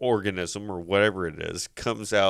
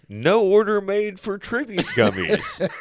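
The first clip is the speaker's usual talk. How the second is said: much higher title read with a laugh; livelier title read; 9 semitones higher than usual. Shown as a demonstration. Podcast host redoing the sterile movie title, livelier. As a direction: sell the movie title, high-pitched and breaking into laughter. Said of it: "that was better".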